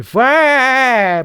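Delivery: a high, loud mock alarm cry; enthusiastic yell. Imagining his dash cam catching his last words, a high loud yell. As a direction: yell it out like the car's on fire. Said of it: "it will be enthusiastic".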